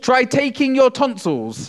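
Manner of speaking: loud, high, slow alliteration; tongue twister demo. Shown as a demonstration. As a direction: punch each T sound. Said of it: "I want you to repeat after me".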